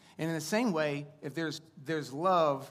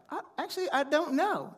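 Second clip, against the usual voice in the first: put-on much higher little kid voice, unsure; imitating five-year-old; 13 semitones higher, 4 dB louder than usual. Father imitating his young son, higher child voice. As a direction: play the small son backing out. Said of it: "and then also be like".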